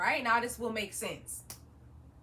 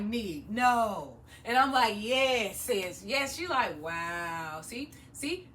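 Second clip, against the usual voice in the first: lightly voiced Jamaican mom, low and drawn out; a hint of a Jamaican mother saying see, see; imitating Jamaican mom; 4 semitones lower, slower than usual. Jamaican mom hearing the salon story, mild Jamaican accent. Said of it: "And she's like".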